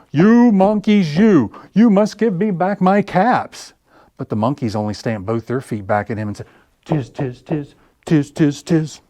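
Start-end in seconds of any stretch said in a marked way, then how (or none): 0.1-3.7 much higher, loud, slow furious yell; furious shout. Acted. 6.8-9.0 chattering monkeys. Acted. Monkeys mimic the peddler.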